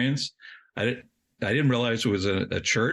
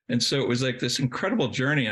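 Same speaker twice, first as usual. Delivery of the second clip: ordinary talk, nothing marked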